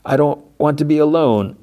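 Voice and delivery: put-on high, pleading old woman's voice; Bogdana pleading. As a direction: pitch it way up, begging him to see sense. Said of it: "And she's like".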